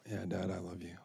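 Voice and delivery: soft, subdued acted reply; resigned. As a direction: give in quietly to Dad. Worn-down girl gives in.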